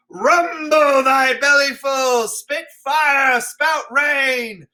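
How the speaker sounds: put-on old king roaring at the elements; raging king. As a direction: dare the storm to do its worst. Lear raging at the storm.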